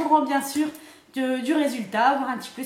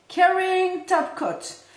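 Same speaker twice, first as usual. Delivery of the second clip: put-on slow, raised French-tinged English accent; 6 semitones higher, much slower than usual. Vlogger reading a product name to viewers, put-on English accent. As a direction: stretch it out in a showy English accent.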